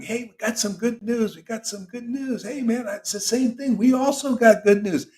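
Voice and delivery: playful imitation of Jesus hawking good news; Jesus spreading news. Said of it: "What do you think Jesus going around".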